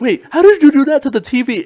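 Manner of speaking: high, loud mock astonishment; imitated amazed kid. Retelling little sister baffled by the TV, mock amazement.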